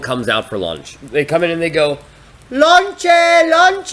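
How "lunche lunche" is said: high, loud vendor's call, mimicked; imitated yelling. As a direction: call out lunch like a street seller. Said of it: "they all start yelling".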